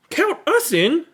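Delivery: happy shout of joining; eager exclamation. Acted. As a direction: jump in with delight. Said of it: "exclaimed Seven".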